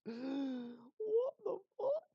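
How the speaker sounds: very high, drawn-out disbelief; mock shock. Armed dad spots intruder in hallway, high mock shock. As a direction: squeal it slowly, aghast.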